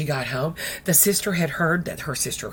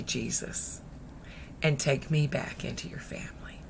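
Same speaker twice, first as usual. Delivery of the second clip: soft, low, whispery plea; hushed prayer; 4 semitones lower, 8 dB quieter than usual. Acted. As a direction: plead quietly in prayer. Models a repentant prayer.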